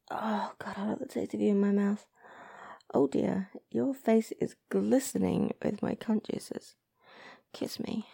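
very soft, low, half-whispered; intimate murmur. Acted. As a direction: murmur close to a lover. Intimate murmuring to partner afterwards.

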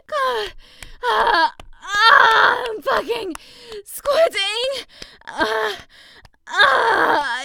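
very high, loud ecstatic cries; climax cries. Acted. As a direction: scream through the climax. Climax screams.

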